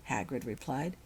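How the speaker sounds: usual speaking voice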